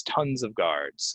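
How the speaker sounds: tons of guards said slowly and clearly; slow it right down; slow repeat. Shown as a demonstration. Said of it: "slow repeat".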